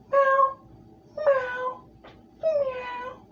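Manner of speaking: very high snail meow; Gary meow. Shown as a demonstration. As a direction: squeeze out a tiny high meow. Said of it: "Gary is the equivalent to a cat, but a higher pitch".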